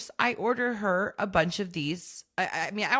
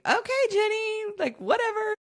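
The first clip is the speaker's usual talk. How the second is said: much higher, airy go-along voice; carefree follower. Retold or imitated. Following bossy sister Jenny on vacation, a high carefree go-along voice. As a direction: float along without a care. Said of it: "you just literally follow along going".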